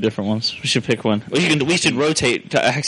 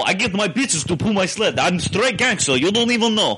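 higher, boastful mock Russian; Russian accent; 5 semitones higher than usual. Friend bragging in the accent game, comic Russian accent. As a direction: ham up a Russian gangster accent. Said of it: "In Mother Russia".

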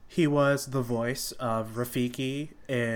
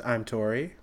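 ordinary delivery

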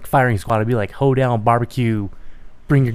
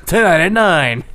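newscaster voice; 5 semitones higher, 7 dB louder, slower than usual. Retold or imitated. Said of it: "newscaster voice".

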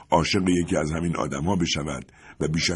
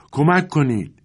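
a high, louder call for help from the yard; cry for help; 9 semitones higher, 3 dB louder, slower than usual. A narrator's reading. A voice in the yard calls for help, shouting. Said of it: "صدایی در حیاط پیچید".

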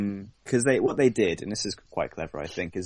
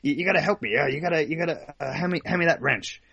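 higher, fast hammed-up urgency; frantic Rick impression; 8 semitones higher, faster than usual. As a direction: demand the wrench in a rush. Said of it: "It's like".

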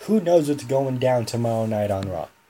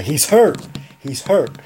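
mock yell, louder; retold scream; 4 semitones higher, 7 dB louder, slower than usual. Jericho yelling to the ref that Neville is hurt, a louder mock yell. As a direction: shout it like a panicked wrestler. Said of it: "he just screams out".

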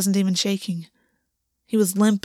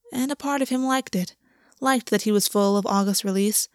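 plain reading voice

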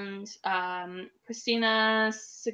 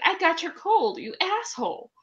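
higher, half-joking accusation; mock angry complaint; 7 semitones higher than usual. Wife mock-scolding husband who gave her his cold. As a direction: pretend to scold him for the cold. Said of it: "and I'm like".

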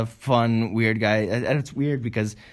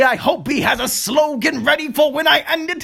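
announcer outro; 7 semitones higher than usual. Acted. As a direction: hype it like a show host.

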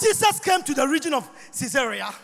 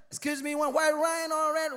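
lightly voiced attendant, softer; polite mock attendant; flight attendant; 8 dB quieter than usual. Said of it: "they said".

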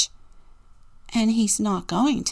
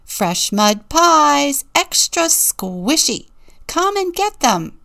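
loud, higher, slow seller's cry, climbing; a louder street-vendor call; 7 semitones higher, 5 dB louder than usual. A narrator's reading. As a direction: hawk the pies, rising higher on the last words. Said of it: "Ava called".

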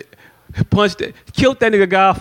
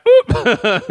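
very high squeaky oop; mock oop. Women sneaking out side door, high squeaky oop. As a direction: squeak it as you slip away.

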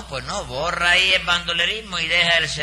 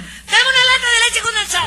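much higher, louder, slow put-on old lady whine; hammed-up falsetto of a fussy customer; mocking Nananina's voice; 5 dB louder than usual. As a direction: mimic the customer in a shrill falsetto. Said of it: "me dijo".

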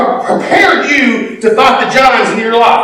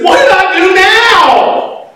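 raised, slow mock protest; indignant retort; 8 semitones higher, slower than usual. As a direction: act wronged and puzzled. David's retort to brother.

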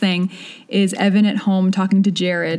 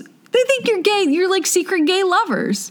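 teasing friend; 11 semitones higher than usual. Retold or imitated. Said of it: "He goes".

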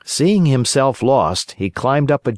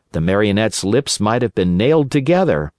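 plain reading voice

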